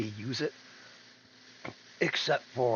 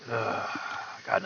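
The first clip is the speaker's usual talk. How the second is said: ordinary talk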